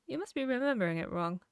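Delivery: ordinary talk, nothing marked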